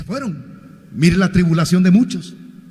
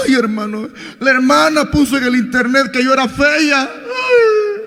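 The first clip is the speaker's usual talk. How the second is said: hammed-up whimper ending in a high fake cry; mock whining; 5 semitones higher, slower than usual. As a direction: parody a crybaby believer, wailing "Ay" up high. Mocking whiny church members.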